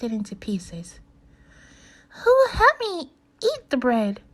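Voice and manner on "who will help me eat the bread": high, loud hen voice; hen asking. A narrator's reading. Hen offering the bread to the animals, high and loud. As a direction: call it out high as the little hen. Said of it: "she asked".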